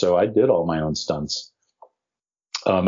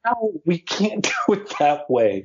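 laughing; 9 semitones higher, slower than usual. Retold or imitated. Director answers while laughing.

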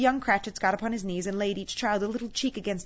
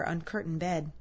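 usual speaking voice

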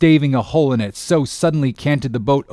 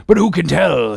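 usual speaking voice

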